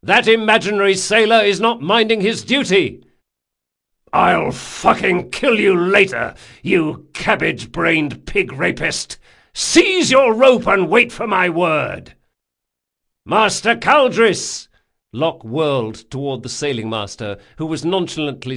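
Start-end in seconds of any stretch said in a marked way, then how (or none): 0.0-3.0 high, loud officer's complaint; called out. A narrator's reading. Captain to first mate about a sailor, loud shout. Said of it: "called Locke". 4.1-12.1 slow, loud, high tirade; angry bellow. A narrator's reading. First mate cursing an imaginary sailor, angry bellow. 13.3-14.7 slow, high, loud hail; calling out. A narrator's reading. Captain to sailing master, shouted summons.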